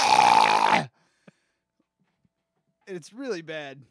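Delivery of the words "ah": harsh retching roar; loud burp-puke noise. Retold or imitated. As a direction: belt out a gross vomit burp. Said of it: "I make the burp noise when I puke. I go".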